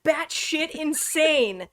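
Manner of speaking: batshit insane drawn out slowly, both words stressed; slow stressed outrage. Real, not acted. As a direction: drag out batshit insane in disbelief. Outraged at the awful book.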